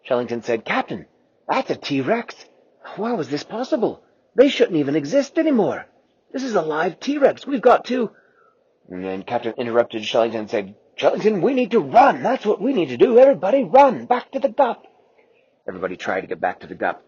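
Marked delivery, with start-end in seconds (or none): 0.6-8.1 high, rising disbelief; alarmed. Acted. Shellington alarmed, telling the captain about the live T-Rex. 10.9-14.9 high, loud order to run; urgent shout. Acted. Captain cutting Shellington off, shouting an order to run.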